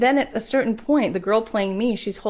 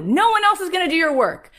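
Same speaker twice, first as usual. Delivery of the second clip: high and loud, hammering it home; loud insistent take; 11 semitones higher, 7 dB louder than usual. Retold or imitated. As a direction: shout it the first time. Repeated, first take.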